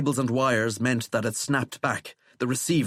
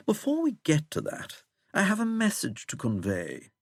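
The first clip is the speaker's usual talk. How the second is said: plummy English, high then settling; plummy English accent; 9 semitones higher than usual. A narrator's reading. Withering announcing his message to the president, plummy English accent. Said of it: "in that plummy English accent".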